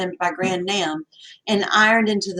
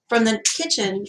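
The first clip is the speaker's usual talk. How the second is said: ordinary delivery, nothing marked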